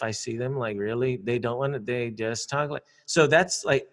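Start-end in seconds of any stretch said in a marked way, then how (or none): none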